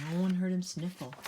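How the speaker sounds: ordinary delivery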